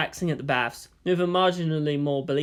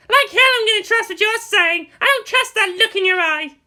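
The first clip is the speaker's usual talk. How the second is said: put-on high, loud girl yelling; shouting; 7 dB louder than usual. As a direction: yell in outrage at a groping hand. Asuka shouting at Rei.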